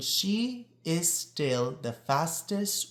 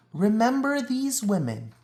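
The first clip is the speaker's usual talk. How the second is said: higher and louder, a rallying appeal; rousing call; 8 semitones higher, 4 dB louder than usual. A narrator's reading. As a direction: lift it up like a call to action. Closing call to the listener.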